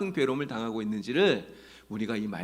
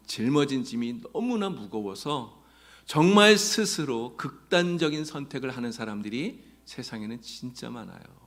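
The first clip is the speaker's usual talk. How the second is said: normal talking voice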